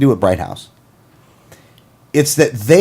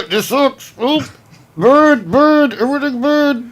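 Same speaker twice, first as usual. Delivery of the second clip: high, gasping words on the in-breath; talking while inhaling; 12 semitones higher, much slower than usual. Shown as a demonstration. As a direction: gasp the words inward, slowly. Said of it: "You can only speak while inhaling".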